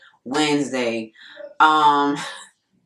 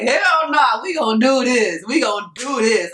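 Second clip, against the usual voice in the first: defiant determination; 5 semitones higher than usual. Retold or imitated. Defiant resolve.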